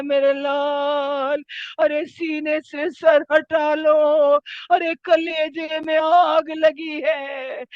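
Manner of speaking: anguished, drawn-out cry; writhing in agony. Acted. Dying Imam to his son at his chest, agonised wail. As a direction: wail it out as if in torment. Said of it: "تڑپ کر کہتا ہے".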